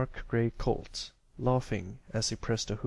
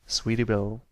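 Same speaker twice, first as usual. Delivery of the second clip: ordinary delivery, nothing marked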